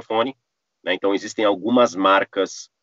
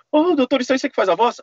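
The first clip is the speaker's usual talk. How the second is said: imitando um fã, bem mais agudo; voz aguda e animada de fã pedindo; fã empolgado; faster than usual. Retold or imitated. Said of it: "o que todo mundo pede, fala assim". (fã pergunta ao dublador, bem mais agudo e animado)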